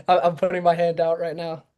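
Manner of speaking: pleading through laughter; laughing plea. Pretend. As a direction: beg while laughing.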